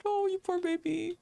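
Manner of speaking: ordinary delivery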